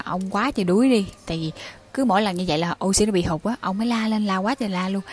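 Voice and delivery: ordinary delivery, nothing marked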